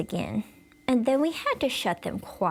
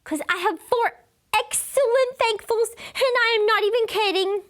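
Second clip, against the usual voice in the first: eager bragging; 13 semitones higher than usual. Acted.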